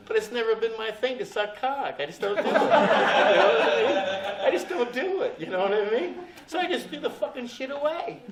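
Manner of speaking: high, loud laughter through the words; wheezing with laughter at himself; laughing. Real, not acted. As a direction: laugh your way through the confession. Laughing at his own story.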